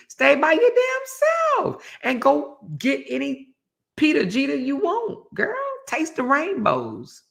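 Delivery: sassy advice. Acted. Sassy advice to single women.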